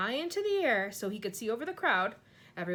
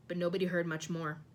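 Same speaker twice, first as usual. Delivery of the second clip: usual speaking voice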